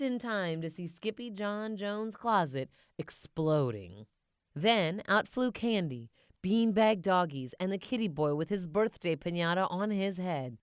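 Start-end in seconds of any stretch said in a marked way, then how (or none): none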